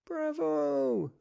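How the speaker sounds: put-on very high, thrilled child's cheer; delighted bravo. A narrator's reading. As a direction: applaud with pure joy. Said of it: "applauded Mister Swift".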